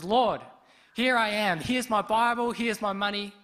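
high, loud pleading to God; earnest prayer. Retold or imitated. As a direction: offer yourself up, all in.

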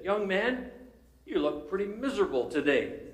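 high, loud pulpit call; mock preacher singling out a stranger. Preacher calling to the young man under the gallery, high and loud.

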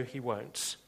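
normal talking voice

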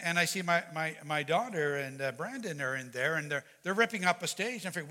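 usual speaking voice

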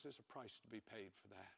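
soft, grave warning to the church; hushed warning. Acted. Preacher warning the congregation after a hard story, hushed. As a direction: drop the voice to a hush.

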